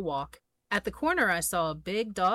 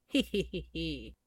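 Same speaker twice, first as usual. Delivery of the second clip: elephant giggle; 4 semitones lower, 5 dB quieter than usual. Acted. The elephant giggling at the mouse's trick, a light acted giggle. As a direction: giggle like you can't help it. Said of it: "He began to giggle".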